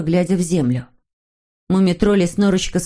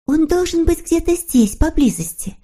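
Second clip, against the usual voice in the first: put-on very high, sweet girlish character voice; eager little girl figure; 10 semitones higher than usual. A narrator's reading. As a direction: agree gladly in a high little voice. Snork Maiden voiced in story. (тоненький девичий голосок)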